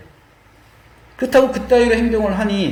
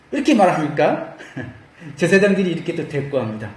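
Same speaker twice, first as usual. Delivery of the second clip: ordinary talk, nothing marked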